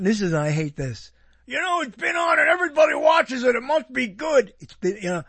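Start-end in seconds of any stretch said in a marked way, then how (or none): 1.5-4.5 louder, higher mock defender; mocking fan insistence. Scoffing at fans who say long shows must be good, loud mock insistence. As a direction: ham up the smug fan's reasoning.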